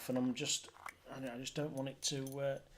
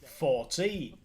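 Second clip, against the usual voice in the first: bingo caller; 2 semitones higher, 14 dB louder, much slower than usual. Acted. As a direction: call each number out like a bingo hall. Bingo calling on stream.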